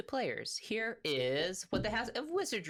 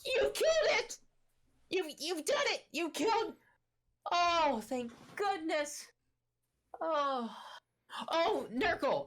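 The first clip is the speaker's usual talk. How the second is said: ecstatic squeaky gnome; 14 semitones higher than usual. Acted. Gnome king cheers the heroes for killing the mimic, in a very high ecstatic squeal. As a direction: shriek with joy like a tiny gnome freed from dread. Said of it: "He goes".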